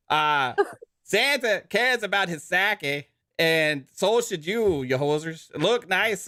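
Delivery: mock Canadian accent reading a sponsor ad. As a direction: sell the razor like a joking Canadian, eh. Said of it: "he's definitely Canadian right now".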